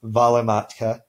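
"valematka" stressed on the first syllable, VA-lematka; Finnish stress. Shown as a demonstration. Teacher showing Finnish first-syllable stress on "valematka". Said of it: "each of those first syllables is stressed".

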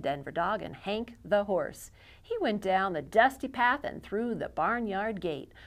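ordinary delivery, nothing marked

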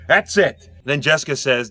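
ordinary delivery

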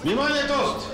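calling out. Acted. Calling guests to attention for toast.

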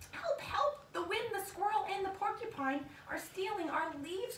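high, urgent mock cry for help. Little bear calling out to the forest, mock panicked cry. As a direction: call out in playful alarm. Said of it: "cried Fletcher".

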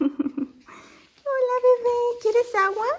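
high, loud coaxing call; calling the new puppy over, high and coaxing. Real, not acted. Greeting the puppy.

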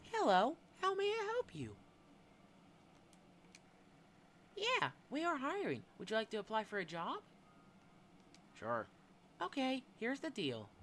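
high-pitched cheerful employer pitching a shady job; hiring boss character. Acted. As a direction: squeak it like a chirpy little boss offering a job.

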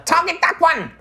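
tiny fairy commander, loud and high; rally the men in a piping little voice; high-pitched warrior captain. Acted. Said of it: "kind of high-pitched voice".

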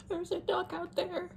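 sudden high squeak; high squeaky voice. Real, not acted. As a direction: jump way up, squeaky. Joking hallucination of a duck.

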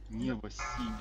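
usual speaking voice

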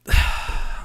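long, spent sigh; heavy sigh. Real, not acted. Streamer worn out by donations, heavy sigh. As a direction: let out a big exhausted breath.

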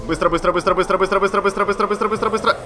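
high, hurried repetition with no gaps; frantic urgency. Real, not acted. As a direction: rattle it off in a rising panic. Racing through urgent game moment.

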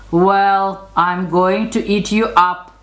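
a touch of storybook menace; menacing troll. A narrator's reading. Troll threatens the second goat, mild menace.

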